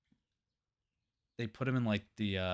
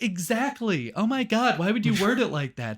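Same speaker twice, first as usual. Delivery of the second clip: high, loud mock disbelief; exasperated exclamation; 10 semitones higher, 7 dB louder than usual. Therapist reacting to Renfield's odd wording, exasperated. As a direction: act out a worked-up therapist.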